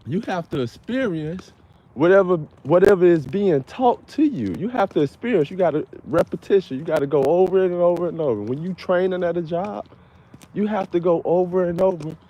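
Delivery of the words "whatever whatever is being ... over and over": emphatic rant. Real, not acted. Ranting about repetition at work.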